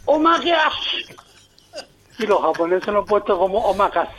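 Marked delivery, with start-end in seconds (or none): 0.0-1.2 high, drawn-out Canarian call to ma; castellano coloquial canario. Shown as a demonstration. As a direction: call out to mom in slow, high colloquial Canarian. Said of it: "Pero en castellano es". (castellano canario, agudo y alargado)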